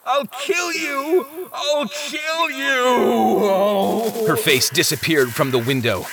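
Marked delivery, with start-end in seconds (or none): 0.0-4.3 high drawn-out giantess scream. A narrator's reading. Giantess rage.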